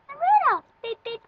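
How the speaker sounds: very high, loud squeal; silly reindeer voice. Pretend. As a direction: announce yourself as a silly reindeer. Playing Rudolph.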